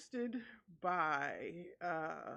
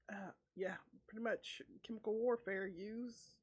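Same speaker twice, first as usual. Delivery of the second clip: usual speaking voice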